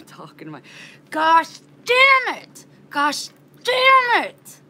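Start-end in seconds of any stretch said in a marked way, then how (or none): 1.1-4.6 high, drawn-out furious yell; angry yelling. Retold or imitated. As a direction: re-enact yelling your head off in the car. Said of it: "I was just yelling in, in my car".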